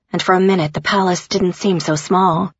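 plain reading voice

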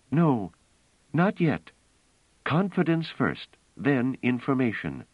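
a woman insisting on the plan; Bliss, urgent and intent. Acted. Bliss urging Pellerat to play robots, earnest.

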